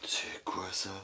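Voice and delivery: breathy whisper, puzzled; curious hushed whisper; whispered question. Real, not acted. Finding odd object, whispering.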